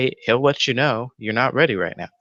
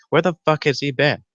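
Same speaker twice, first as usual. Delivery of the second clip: higher, exasperated demand; heated outburst; 6 semitones higher than usual. Real, not acted. Fan venting about a missing Howland Reed, heated outburst. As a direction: let the frustration boil over on the question. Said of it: "Got real heated up".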